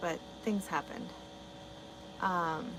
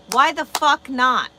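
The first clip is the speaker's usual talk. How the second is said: loud, slow, defiant comeback; defiant retort; 5 semitones higher, 15 dB louder, slower than usual. Real, not acted. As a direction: throw the question back, loud and drawn out. Answering "don't curse" comment.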